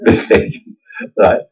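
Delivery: ordinary talk, nothing marked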